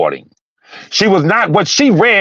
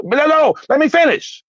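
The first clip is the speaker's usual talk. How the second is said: normal talking voice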